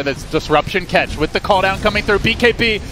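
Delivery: fast, rising live commentary; play-by-play. Acted. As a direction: call the fight as it happens. Live play-by-play of a team fight.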